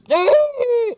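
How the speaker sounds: high, loud imitated distant scream; mock muffled shriek. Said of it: "like, muffled screaming".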